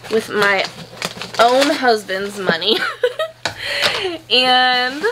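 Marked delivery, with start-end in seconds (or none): 2.8-4.3 high giggle. Real, not acted. Joking about husband's money.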